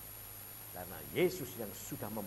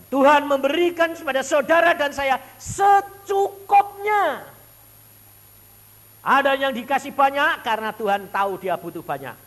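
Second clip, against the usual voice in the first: loud, high preaching at full force; 18 dB louder than usual. Acted. Preacher proclaiming to the congregation, loud high preaching. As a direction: proclaim it to the church.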